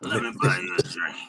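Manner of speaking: deep, low seductive come-on; low crooning pickup line; smooth pickup line. Pretend. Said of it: "All right, well, you know".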